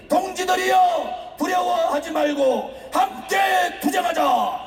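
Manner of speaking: slow, high battle-cry to comrades; roaring rallying speech. Real, not acted. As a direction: draw out the call to fight. Rally climax call to fight. (느리고 높은 투쟁 구호)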